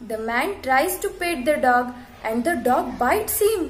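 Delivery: normal talking voice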